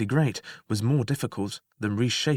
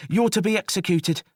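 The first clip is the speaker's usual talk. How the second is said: high, firm sentence; jailer voice; 9 semitones higher, 4 dB louder than usual. Acted. Jailer telling the prisoner his fate, high. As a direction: say it high and regretful.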